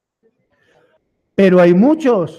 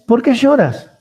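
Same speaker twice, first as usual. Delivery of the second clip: louder, higher, pressing question; emphatic repeat; 6 semitones higher, 6 dB louder than usual. Acted. Preacher pressing God's question on the congregation, louder. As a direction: push the question home to the congregation.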